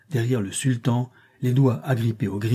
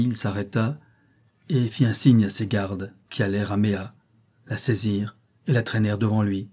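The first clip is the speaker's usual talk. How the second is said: plain reading voice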